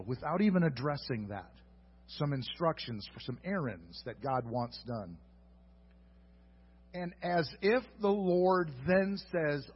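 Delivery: normal talking voice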